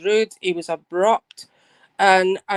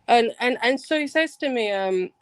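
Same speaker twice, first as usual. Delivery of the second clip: ordinary delivery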